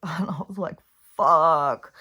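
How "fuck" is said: louder blurted curse; a sudden loud mock curse; overwhelmed outburst. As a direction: let the overwhelm burst out. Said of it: "I got super overwhelmed".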